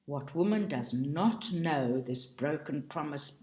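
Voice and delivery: ordinary delivery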